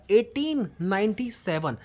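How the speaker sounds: usual speaking voice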